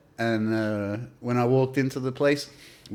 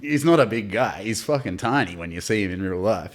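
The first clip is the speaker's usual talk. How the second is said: ordinary delivery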